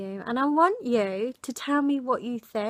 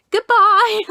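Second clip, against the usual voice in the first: high, loud, dismissive goodbye; 11 semitones higher, 14 dB louder than usual. Retold or imitated. Telling the rude man goodbye, loud and dismissive. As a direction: shut him down with a shouted farewell.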